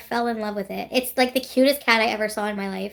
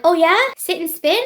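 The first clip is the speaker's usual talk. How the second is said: higher, louder mock challenge; retold taunting retort; 7 semitones higher, 7 dB louder than usual. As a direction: throw it out like a cocky comeback. Acted retort in retold argument.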